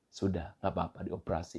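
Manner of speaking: low, very soft acted husband; soft reassurance. Husband to his wife before surgery, soft reassurance. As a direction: hush it right down to console her.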